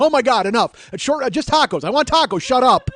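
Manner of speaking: loud, high mock exasperation; exasperated. Customer who just wants tacos, exasperated at a stranger. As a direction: cut him off, comically fed up.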